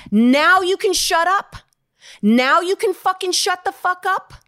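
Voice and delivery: retold tirade, loud and high, screaming in her face; furious yelling. Actor reenacts the star screaming at her on set, furious yell. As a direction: rail at her like a star whose scene got ruined. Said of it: "he comes over and he goes".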